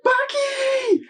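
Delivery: put-on girl screams out to Baki for help, high and loud; desperate scream. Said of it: "She's like".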